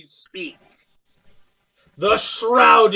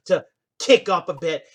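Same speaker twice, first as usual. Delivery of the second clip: ordinary delivery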